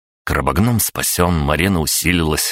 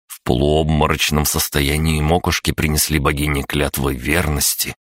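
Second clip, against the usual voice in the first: usual speaking voice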